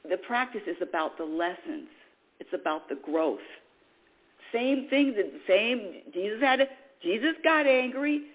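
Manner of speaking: normal talking voice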